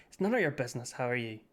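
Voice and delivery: attempted Irish accent, comes out Northern. Shown as a demonstration. Streamer trying an Irish version of the line, Northern Irish accent. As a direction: try Irish, land in Northern Irish. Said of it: "that's still north".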